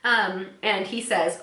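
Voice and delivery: normal talking voice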